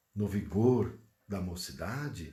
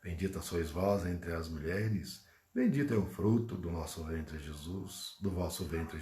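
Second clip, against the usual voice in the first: prayer; 6 semitones lower than usual. Real, not acted. Leads closing Hail Mary prayer.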